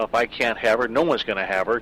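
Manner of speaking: ordinary talk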